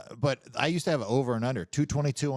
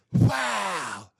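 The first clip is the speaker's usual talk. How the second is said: high, loud falling blast noise; imitated gunshot blast; 10 semitones higher, 5 dB louder than usual. Storyteller voicing the robber's shot, imitated gunshot blast. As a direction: mimic a blast with one drawn-out falling cry.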